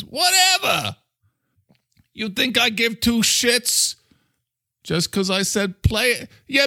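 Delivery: touchy, high-pitched New York character; defensive New Yorker, challenge the listener; Mister Coffee. Acted. New Yorker defending himself to International, high and testy.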